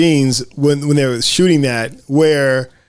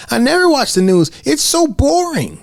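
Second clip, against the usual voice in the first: put-on pitched up, bored child, slowing at the end; high kid voice; 12 semitones higher than usual. Kid dismissing the news to her friend, high and bored. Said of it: "And Mooney says".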